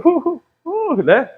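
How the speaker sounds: playful imitated sobs, pitched high; mock sobbing. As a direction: fake a few teary sobs, half laughing. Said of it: "ainda vê um testemunho e chora". (soluços de brincadeira, agudos)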